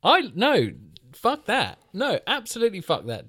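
high-pitched exasperated refusal; indignant outburst. Real, not acted. Horror hater rejecting cheap jump scares, high indignant protest. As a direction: let the voice shoot up in disgust.

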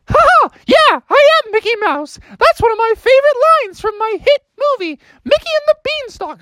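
very high, cheery falsetto mouse; Mickey Mouse. Acted. Mouse boasts of his hit movie, high cartoon squeak. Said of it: "Who are you, Mickey Mouse?".

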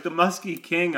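high-pitched retold amazement; disbelief. Storyteller shaking his head at the man's boast, disbelieving. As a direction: repeat it as if unable to believe it. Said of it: "shaking my head in disbelief".

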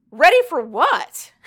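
loud, high, drawn-out disbelief; hammed-up shocked question; incredulous. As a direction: act out an alarmed, incredulous comeback. Retold heroine reacts to captor's threat.

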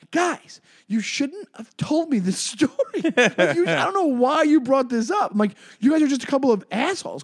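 high, loud exasperated outburst; outraged disbelief. Retold or imitated. Comic to the couple, high exasperated shout. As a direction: scold them for sharing the story.

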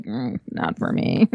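low, slow, put-on dismissive murmur. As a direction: brush it off in a low drawl. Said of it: "I was like".

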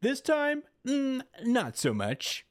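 lightly voiced villain, rising high at the end; a hint of a gloating villain. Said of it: "All For One is like".

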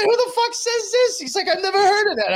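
loud, very high mock disbelief; incredulous. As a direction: imitate him, voice shooting up in bafflement. Acting out lieutenant's disbelief at slang.

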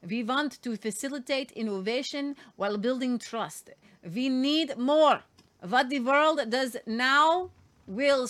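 mock European villain accent; deliberate put-on foreign bad guy accent. Host reading an EU speech on AI, bad guy accent. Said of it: "this is my bad guy helmet".

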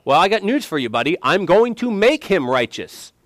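high, loud, emphatic acted comeback; God's retort. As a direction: answer the challenger with forceful confidence. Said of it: "And God says".

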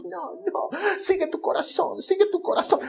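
listener's heart mocked sweetly; mock sweet advice. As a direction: parody syrupy Disney advice. Mocking feel-good advice.

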